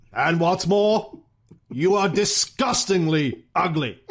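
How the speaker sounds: slow, theatrical statesman's retort; measured put-down with long dramatic pauses; Churchill. Acted. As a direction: deliver each insult with pompous relish. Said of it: "whereupon Churchill replied".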